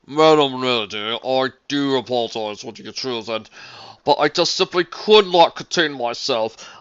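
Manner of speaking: a polished gentleman caller; dapper gentleman voice. A narrator's reading. Mister Marmalade voice.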